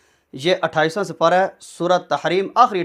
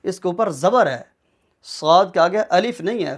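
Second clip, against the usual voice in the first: usual speaking voice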